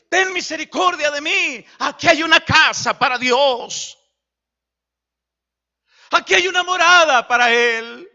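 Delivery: grito fuerte y agudo, lento al principio; clamor suplicante. Retold or imitated. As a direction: implora a gritos que entre y limpie la casa. El ciego clama a Jesús. (ciego clamando a Jesús, con fuerza y súplica)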